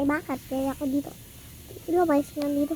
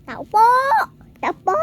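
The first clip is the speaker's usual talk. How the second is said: calling from far away at the door; loud voice; 10 semitones higher, 10 dB louder, faster than usual. Acted. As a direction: shout it out to the house. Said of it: "Lakas ng boses".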